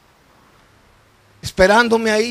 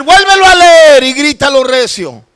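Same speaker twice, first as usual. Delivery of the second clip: insistent retold shout; shouted order; 5 semitones higher, 11 dB louder than usual. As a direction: demand it at the top of the voice. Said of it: "luego me vuelve a decir".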